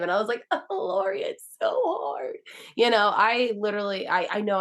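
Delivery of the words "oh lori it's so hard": high, slow whining wail; mock whine. Complaining to Lori on the porch, a high slow whine. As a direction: stretch out hard in a whiny plea.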